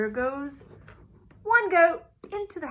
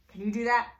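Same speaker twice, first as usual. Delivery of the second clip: ordinary delivery, nothing marked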